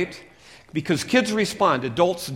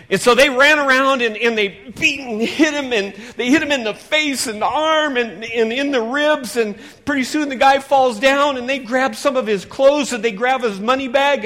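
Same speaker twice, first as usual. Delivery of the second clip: higher and louder, racing storytelling; excited; 7 semitones higher, 10 dB louder than usual. A narrator's reading. Preacher acting out the robbery with rising excitement. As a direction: pile on the action breathlessly.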